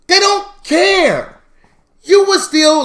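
high, loud, stretched-out outburst; exasperated yell. Acted. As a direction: holler it slowly at the excuse-maker. Exasperated at the written excuse.

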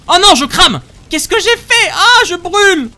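high, loud yelps of alarm; real panic, stretched-out wail; panicked. Real, not acted. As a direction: cry out as you catch fire. His character catches fire.

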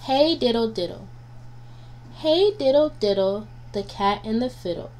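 plain reading voice

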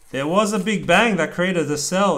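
steady rhythmic picture-book reading; reading aloud to child. A narrator's reading. Dad reading a rhyming book to his child, lilting read-aloud. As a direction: read the rhyme out to your kid.

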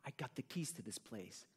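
soft, conspiratorial acted line; hushed secret. Shown as a demonstration. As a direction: share it like a secret in a game. Improv line given to partner.

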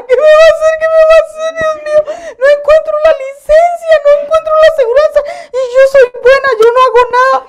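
hammed-up wailing plea, very high and loud; mock-sob loudly in a high-pitched panic; llanto imitado. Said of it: "me solté a llorar". (llanto exagerado)

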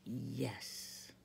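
low, soft and deep-set; a great voice behind them; great lion voice. A narrator's reading. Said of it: "a great voice from behind".

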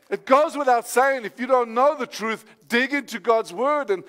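ordinary talk

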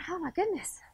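a little winded, catching her breath. Real, not acted. Teacher, winded from her workout, catching her breath. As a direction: puff it out after exercise. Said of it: "Got a little out of breath".

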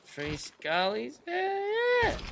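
high, slow, thrilled squeal; squealing excitement. Acted. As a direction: squeal it like a delighted pirate. Mock excited player voice.

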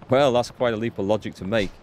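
usual speaking voice

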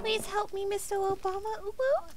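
put-on soft, high-pitched child plea; small child voice. Echoing the child's plea to Obama, small child voice. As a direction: plead softly in a tiny high voice. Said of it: "sounds like we're talking to a small child".

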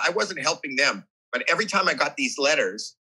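ordinary delivery

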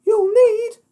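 very high, loud piggy falsetto; taunting pig. Acted. Pig mocks the wolf's puff, high and squeaky.

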